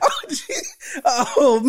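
high breathless laughing gasp; gasping with laughter; laughing hard. Real, not acted. Laughing at the insult.

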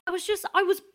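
hammed-up offended creator telling off a critic; mock indignation. Show creator scolds Harvey for knocking the idea, mock indignant. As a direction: play wounded outrage, building in pitch.

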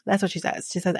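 ordinary talk

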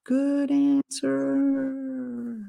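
slow, high, drawn-out otherworldly voice; voicing God answering her, slow and high. Near-death story, God's voice, slow and high. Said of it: "I hear God say".

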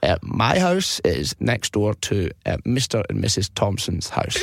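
put-on slow, rambling old man; old guy. Old guy gives useless address to taxi man, slow voice. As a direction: take ages over it like a doddery caller. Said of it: "pretend to be an old guy".

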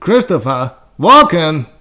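drawn-out, oddly punched name; Christopher Walken. Shown as a demonstration. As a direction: attempt a bad celebrity impression, dragging each word. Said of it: "I can't do a Christopher Walken impression".

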